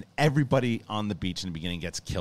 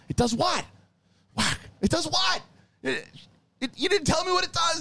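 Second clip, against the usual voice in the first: very high, squealing indignant voice; mock Harley Quinn outrage. Harley scolding the dying man over the javelin, high squealing mock rage. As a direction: shriek it like a betrayed girl slapping him. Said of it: "She goes".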